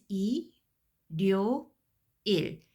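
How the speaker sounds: 이, 료, 일 said apart, slowly, with the l carried into the next syllable. Shown as a demonstration. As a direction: break 일요일 into 이, 료, 일, one slow syllable at a time. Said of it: "I'm going to pronounce it as".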